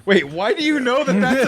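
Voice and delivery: asks through a laugh, pitch up; laughing disbelief. Real, not acted. To her cohost about the pope page, laughing. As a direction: laugh out the question, amazed.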